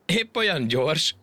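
high, slow, drawn-out question; mocking amusement. A narrator's reading. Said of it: "a hasát fogta nevettében". (magas hangon, elnyújtva gúnyolódva)

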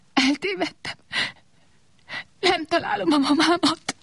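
high, small voice, a touch of sobbing; little lost watering can whimpering for its mum; a hint of crying, high and small. A narrator's reading. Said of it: "hüppögött a kis locsoló".

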